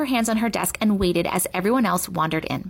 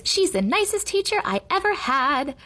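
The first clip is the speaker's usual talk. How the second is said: high lilting praise; high sing-song voice; 8 semitones higher than usual. A narrator's reading. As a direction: chant the praise in a high lilt. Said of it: "in a sing-song voice".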